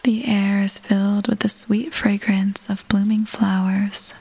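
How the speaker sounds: ordinary talk, nothing marked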